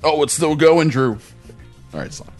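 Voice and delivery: ordinary delivery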